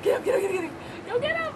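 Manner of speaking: excited urging. Real, not acted. Urging dog to chase bubbles.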